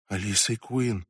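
low, soft murmur; whispered. A narrator's reading. Lizon cornered by the senator's wife, whispering. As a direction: murmur the name under your breath. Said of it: "прошептала Лизон".

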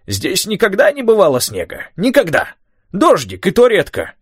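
put-on high, worked-up old man rant; agitated old man. A narrator's reading. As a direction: rant to keep the boy going. Tom ranting about weather.

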